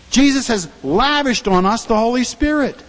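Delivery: normal talking voice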